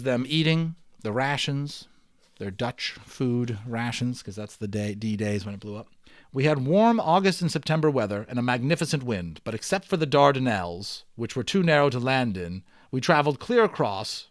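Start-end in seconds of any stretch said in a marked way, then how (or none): 6.3-14.3 steady read-aloud storytelling voice; story narration. Acted. Teacher reading the balloon novel aloud to students, storytelling narration. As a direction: read it like a chapter book to the class.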